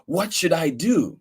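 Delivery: plain reading voice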